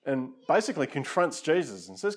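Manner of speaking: usual speaking voice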